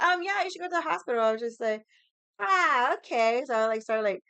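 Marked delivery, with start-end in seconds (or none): 0.0-1.2 high, fast mock mom voice; imitating mom. Mom's advice quoted again. 2.4-3.4 loud, high startled "ah, okay". Retold or imitated. Reacting to mom's answer.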